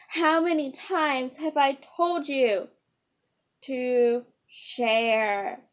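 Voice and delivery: loud, exasperated telling-off; loud scolding. Acted. Mother scolds the boys about sharing, loud and exasperated. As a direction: scold them like a fed-up mother.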